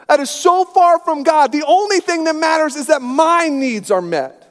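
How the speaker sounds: high, loud whiny tantrum voice; selfish churchgoer as toddler. Pretend. Preacher mocking selfish churchgoers, high and whining. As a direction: whine like a spoiled three-year-old. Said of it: "Oh, here we".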